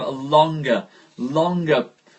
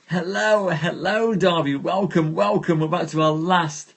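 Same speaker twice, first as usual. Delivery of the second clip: higher, louder cheery hellos; bright welcome; 6 semitones higher, 3 dB louder than usual. Real, not acted. Welcoming viewers joining the live stream, cheery and high. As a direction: greet arriving friends brightly.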